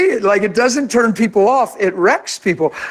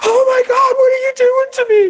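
she cries out, overwhelmed and trembling; crying shout; 13 semitones higher, much faster than usual. Retold or imitated. A stranger at the drive-through window cries out, high and tearful. Woman at window, hand taken.

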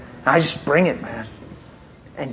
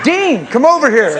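much higher and louder call with a laugh; 11 semitones higher, 7 dB louder than usual. Retold or imitated. Pastor calling leader Dean over at the pond, loud call. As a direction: shout across the water to him.

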